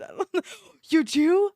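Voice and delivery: ordinary delivery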